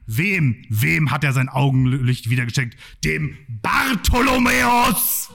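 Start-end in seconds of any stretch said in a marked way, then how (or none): 3.0-5.3 shouting the quiz answer to the crowd, triumphant. Acted. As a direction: crow the answer like a game-show winner.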